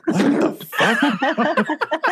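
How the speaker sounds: high, drawn-out disbelieving laugh; mock shocked squeak; incredulous. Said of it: "And I was like".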